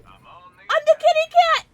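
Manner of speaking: very high, loud, slow squeal; playful kitty shout; excited kitty cry. Pretend. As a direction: proclaim it like a gleeful cat.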